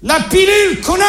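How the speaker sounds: high-pitched angry outburst; exasperated, high-pitched snap; furious punchline. Acted. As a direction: fling the insult at them in exasperation. Parent's furious punchline at the kids.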